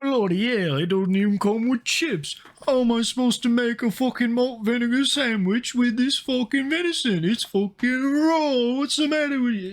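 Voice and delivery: hammed-up outraged Brit, pitched well up; mock British outrage. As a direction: rant like a Brit denied his chips. Streamer voices outraged British diner.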